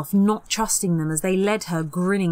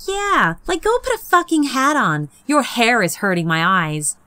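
much higher, louder disgusted whine; groaning taunt; 5 semitones higher, 5 dB louder than usual. Acted. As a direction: whine at her hair in mock disgust. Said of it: "Sarah groaned".

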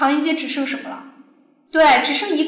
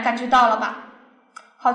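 usual speaking voice

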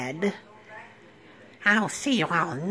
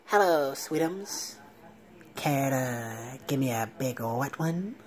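lower, softer seductive croon; a nerd playing it smooth; smooth suave lover; 6 semitones lower, 4 dB quieter than usual. Urkel's cool alter ego asking Laura for a kiss, low smooth croon.

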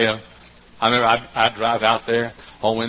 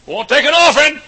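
loud, much higher hollering invitation; calling out; 10 dB louder than usual. Retold or imitated. Young preacher hollering to folks on country roads, calling out. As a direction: holler it from the car window. Repeats his roadside call.

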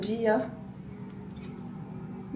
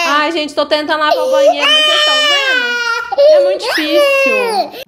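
high, loud half-laughing complaint; exasperated; 14 semitones higher, 11 dB louder than usual. Real, not acted. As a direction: vent to the viewers, pitch climbing. Overwhelmed mom over crying baby.

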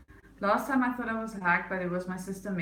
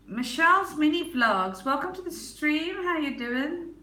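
much higher, bright welcome to the stream; cheery welcome; 8 semitones higher, 4 dB louder than usual. Real, not acted. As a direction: greet a newcomer warmly. Greets new viewer.

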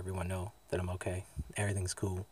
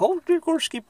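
very high-pitched imitation of a dog owner; mock German woman yelling. Imitating a woman yelling at her dog, high mock yell. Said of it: "she's, like, yelling at this dog".

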